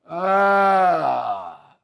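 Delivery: sustained exclamation sliding down in pitch; long drawn-out "ah". Real, not acted. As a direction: hold the "aah" and let it fall. Long exclamation between listener messages.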